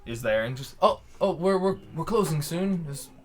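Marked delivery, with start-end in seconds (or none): 0.8-2.9 a shopkeeper caught closing up, flustered. Acted.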